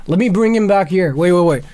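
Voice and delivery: ordinary delivery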